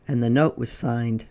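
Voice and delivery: plain reading voice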